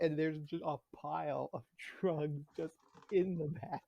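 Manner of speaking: ordinary talk, nothing marked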